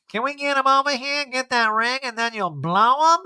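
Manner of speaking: Erica impression. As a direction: play a brazen diva. Erica voiced.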